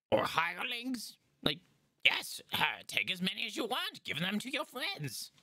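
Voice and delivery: high, eager goblin shopkeeper voice; peppy goblin salesman; goblin merchant. Acted. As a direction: chirp it like a pushy little goblin trader.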